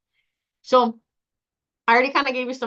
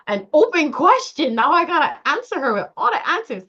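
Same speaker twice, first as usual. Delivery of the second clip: high, loud, hammed-up grumble; mock dismay; 7 semitones higher, 5 dB louder than usual. Caller asked an open question, mock dismay. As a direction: exaggerate the burden in a raised voice.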